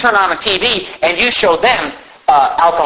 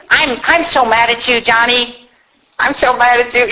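higher-pitched imitation of a scolding parent; mock angry parent; 5 semitones higher than usual. Parents mad at their toddler, mock angry parent. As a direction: mimic a parent fussing at a toddler. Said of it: "you see these parents".

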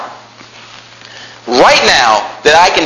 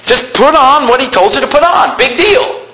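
mock dismissive scoff; 9 semitones higher than usual. Mimicking dismissive churchgoer, raised voice.

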